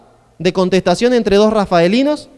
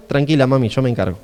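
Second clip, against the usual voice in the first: tender reassurance; 6 semitones lower than usual. Acted. Son promises mother he'll handle it, tenderly. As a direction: lower the voice to calm her. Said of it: "El abrazo tierno es".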